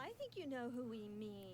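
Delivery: fast unvoiced whisper. Real, not acted. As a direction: hush it like a secret. Conspiratorial aside.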